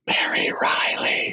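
stretched, whispery film title; slow, spooky whisper on the name; hushed dramatic title. Pretend. Host announcing a film in the contestant list, hushed and dramatic.

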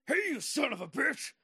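high, loud acted shout; shouted, high-pitched confrontation; enraged wrestler's yell. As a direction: bellow it like an angry wrestler accosting someone. Wrestler confronts him in story.

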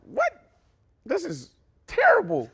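high, exaggerated outraged "What?"; mock shock. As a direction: gasp it out in mock disbelief. His own imitated reaction.

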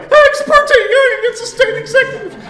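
very high, loud, laughing squeak; squeaky nervous voice. Retold or imitated. Student answering the professor in a high laughing squeak. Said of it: "My voice sounded more like".

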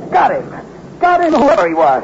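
high excited shout of triumph; triumphant shout. Acted. Martin after shooting the ambusher, high triumphant cry. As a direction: yell out the win.

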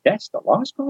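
"That's the law school?" rising high at the end, amazed; surprised question. Retold or imitated. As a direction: echo it back, can't believe it. Echoing back in disbelief.

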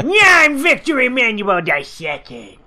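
loud goofy king voice; Adam Sandler voice. Retold or imitated. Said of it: "Again, I talk like Adam Sandler".

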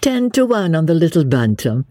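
a touch of merriment, lifting the pitch; merry. A narrator's reading. Marguerite bets on the little bantam, merry. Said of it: "said Marguerite merrily".

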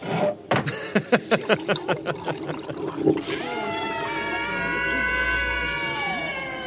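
high, long, wild cackling laugh; manic shrieking laugh. Acted. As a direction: cackle like a man losing his mind. King's manic laugh at the feast.

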